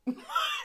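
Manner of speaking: a sudden high-pitched laugh; a real high laugh of disbelief. Real, not acted. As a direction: burst into a high squeal of a laugh. Laughing at the absurdity.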